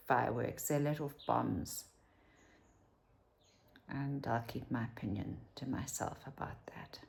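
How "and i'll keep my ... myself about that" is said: soft, breathy whisper to the camera; hushed under-the-breath remark; whispered aside. Real, not acted. Whispered aside about the war.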